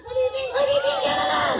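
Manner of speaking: squeaky high puppet voice, shouted out; a cheeky dummy yelling hello from the box; ventriloquist dummy Bob. Acted. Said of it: "we're gonna do some ventriloquism here".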